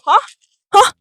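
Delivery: mock the baffled listener straining to hear; confused listener. Friend who can't hear her, high puzzled asking. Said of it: "they go".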